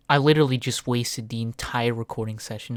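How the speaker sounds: ordinary talk, nothing marked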